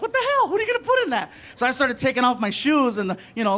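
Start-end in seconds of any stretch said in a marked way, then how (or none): 0.0-1.3 very high, baffled outburst; incredulous. Retold or imitated. Traveler questions a tiny airport tray, high-pitched and incredulous. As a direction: squeal it in comic disbelief. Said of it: "I'm like".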